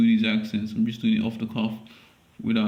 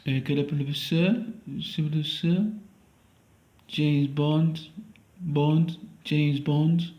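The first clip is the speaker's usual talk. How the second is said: higher-pitched attempt at a French accent; 5 semitones higher than usual. Audition for James Bond in a shaky attempt at French accent. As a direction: put on an improvised French accent. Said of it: "Let me try a French accent".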